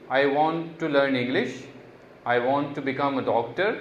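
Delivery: slow, clear model reading; slow model sentences. Shown as a demonstration. Teacher reading slowly for students to repeat. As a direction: say it slowly so students can copy. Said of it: "जिस तरह से मैं बोल रहा हूँ उस तरह से बोलेंगे".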